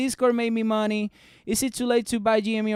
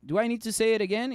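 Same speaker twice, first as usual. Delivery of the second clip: ordinary talk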